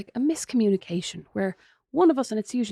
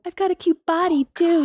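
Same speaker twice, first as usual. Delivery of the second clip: put-on higher, slow little-kid voice; Bo's voice; 9 semitones higher, 4 dB louder than usual. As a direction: channel a proud little boy bragging. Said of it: "similar to the voice that I do for Bo".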